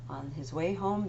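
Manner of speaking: plain reading voice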